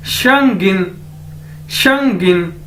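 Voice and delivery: शंगिन said on its own, clearly, twice; model word. Shown as a demonstration. Teacher repeats new word शंगिन for learners to copy. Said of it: "next word है हमारे पास शंगिन".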